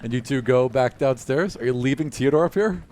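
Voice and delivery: ordinary talk, nothing marked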